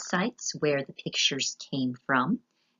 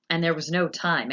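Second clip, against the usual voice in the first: ordinary delivery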